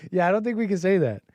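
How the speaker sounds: normal talking voice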